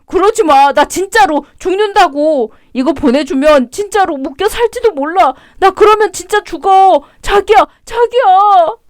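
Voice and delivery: high, whiny begging ex-husband; desperate pleading. Acted. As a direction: beg her not to send it.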